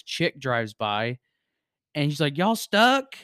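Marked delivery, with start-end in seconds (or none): 2.4-3.1 country drawl. Retold or imitated. Country woman calling from car.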